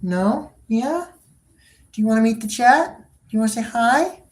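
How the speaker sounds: high, rising coaxing voice; pet talk. Real, not acted. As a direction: coax the cat to greet chat. Coaxing the cat.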